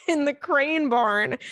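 slow words broken by laughter; laughing through it. Real, not acted. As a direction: giggle out the words. Laughing while reading.

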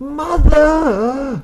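sudden falsetto cry of Mother; high shouted call. Acted. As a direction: yell it up high like a sound cue. Repeats the catchphrase bit.

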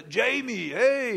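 high, loud mock Schwarzenegger accent; hammed-up Austrian action-star voice calling his son; Arnold impression. Pastor imitates Arnold coaxing his sulking son, hammed-up Austrian accent. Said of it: "that's my best Arnold impression".

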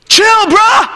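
"Chill, bruh" laut und deutlich höher; mocking teen voice. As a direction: den lässigen Teenager laut nachäffen. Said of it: "he couldn't even say brother".

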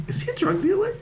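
a high, incredulous question; shocked question. Retold or imitated. To a friend about a guy, high incredulous question.

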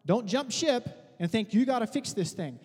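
normal talking voice

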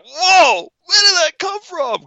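very high-pitched astonishment; acted shock. Viewer reacts to the swearing doll, high shocked voice. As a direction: squeal it in mock disbelief.

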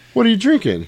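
high, loud mock question; imitating Jules. Jules asks Brett about his drink, high and loud. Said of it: "And he goes".

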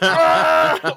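high, loud comic "ah"; mimicked scream of impact; imitated pained cry. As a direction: yelp like a slapstick fall. Imitating the character hitting the rail.

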